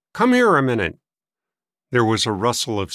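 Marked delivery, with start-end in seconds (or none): 0.1-1.0 higher, louder summons; calling out. A narrator's reading. Old man summoning his sister, calling out. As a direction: call her over from the hall. Brother calls sister from hallway.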